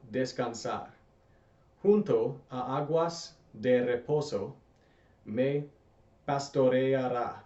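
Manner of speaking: slow, clear Spanish read for repeating; teacher reading Spanish for students to repeat, slow and clear; slow careful reading. Shown as a demonstration. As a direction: read it slowly so learners can echo each word. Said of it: "I'm gonna read this slowly so you can repeat after me".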